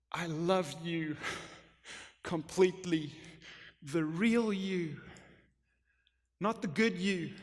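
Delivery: put-on God gently reassuring a loved child; tender God. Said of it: "And God's going".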